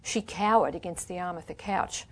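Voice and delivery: plain reading voice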